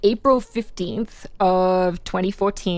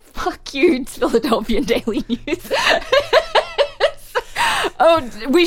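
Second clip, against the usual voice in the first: higher-pitched, breaking into real laughter; laughing outburst; 9 semitones higher than usual. Real, not acted. Reader cracks up at newspaper's dark pun, laughing. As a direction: curse them while cracking up.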